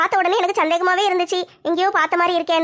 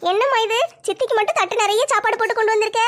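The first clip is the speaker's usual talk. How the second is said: put-on higher, girlish daughter asking her mother; young daughter voice; 4 semitones higher than usual. Daughter asks mother about the sofa, girlish higher voice. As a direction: play the curious teenage girl, pitched up.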